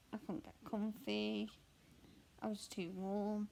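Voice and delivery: usual speaking voice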